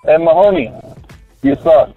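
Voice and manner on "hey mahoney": ordinary talk, nothing marked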